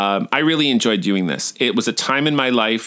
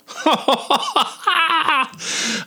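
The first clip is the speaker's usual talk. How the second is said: helpless high laughter, falling off at the end; high giggling laugh. Real, not acted. Cracking up at the instructor's bad boy line, high giggling.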